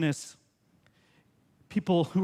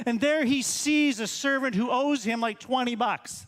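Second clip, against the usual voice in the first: normal talking voice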